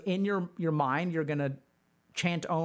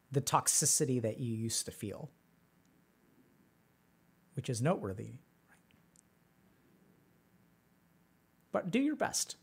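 guided meditation; 5 semitones lower, 6 dB quieter than usual. Acted. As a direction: lead them gently through memory. Leads a meditation.

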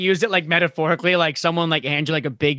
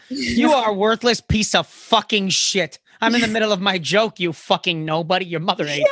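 hammed-up aggressive put-down; mock tirade; 4 semitones higher than usual. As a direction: berate a heckler for comic effect. Acting out cursing a heckler.

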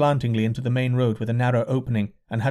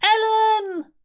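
high shriek with tears in it; tearful shriek; 3 dB louder than usual. A narrator's reading. Mother searching the crowd for her child, tearful high shriek. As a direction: call out for a lost daughter. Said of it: "shrieked a woman in the crowd with tears in her voice".

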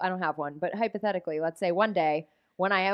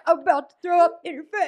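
high, comic about-to-vomit voice; mock sick husband; 9 semitones higher, 4 dB louder than usual. Joking as Dave looming over his sleeping partner, hammed-up queasy voice. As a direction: play someone queasy in a much higher voice.

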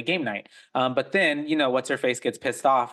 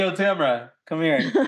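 high calling voice; 5 semitones higher than usual. Retold or imitated. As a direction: yell up the stairs for Tamra. Calling out in the house.

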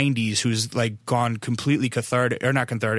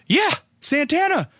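very high, excited imitation of dad; imitated gleeful outburst; thrilled agreement; 3 dB louder than usual. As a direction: exclaim it with childlike delight. Dad thrilled about the song.

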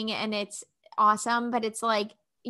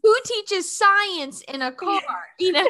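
incredulous friend; 8 semitones higher, 3 dB louder than usual. Retold or imitated. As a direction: marvel like a friend telling a crazy story. Continuing friend's amazed account.

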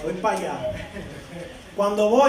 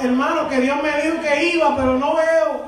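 raised, drawn-out pulpit cries; full-force preaching; 5 semitones higher, slower than usual. Real, not acted. Preacher urging congregation not to let go of God's word, full-force preaching.

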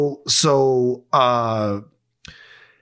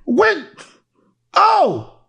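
surprised; 12 semitones higher than usual. Real, not acted. Startled reading the rundown.

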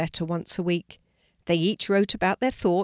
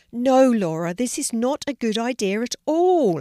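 loud, higher protest; loud exclaiming; 5 semitones higher, 5 dB louder than usual. Acted. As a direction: burst out in alarm. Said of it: "exclaimed Sally".

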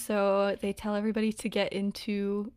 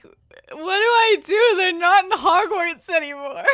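mock panic; 9 semitones higher, 9 dB louder than usual. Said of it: "I was like".

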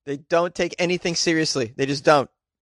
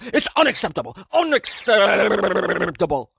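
put-on pompous official fuming about irresponsible kids; McKinstry impression; 6 semitones higher than usual. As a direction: ham up an outraged city councilor. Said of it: "probably talks like this".

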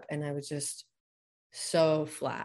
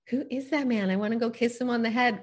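higher, louder giddy wonder; swooning at first sight; retold giddy inner thought; smitten thought; 7 semitones higher, 5 dB louder than usual. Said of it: "I thought".

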